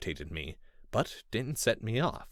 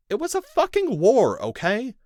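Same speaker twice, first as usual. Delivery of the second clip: heated outburst; 10 dB louder than usual. A narrator's reading. Narrator defends himself heatedly.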